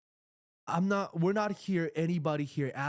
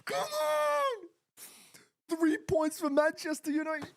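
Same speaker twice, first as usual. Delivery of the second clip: ecstatic shout; slower than usual. Real, not acted. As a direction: scream it out as the win lands. Celebrating the winning goal.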